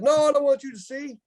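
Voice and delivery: high-pitched retold refusal; hammed-up embarrassed objection; mock protest. Retelling his embarrassed refusal to aunt.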